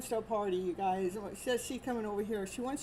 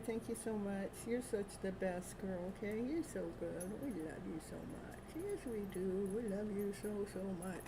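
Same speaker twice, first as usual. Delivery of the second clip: tender to dog; 2 semitones lower, 8 dB quieter than usual. Real, not acted. Owner softly thanking her dog Naya, tender and quiet. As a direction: hush it lovingly to the pet.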